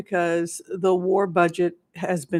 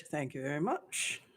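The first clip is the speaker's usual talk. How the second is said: soft mock British thanks; 4 semitones lower, 13 dB quieter than usual.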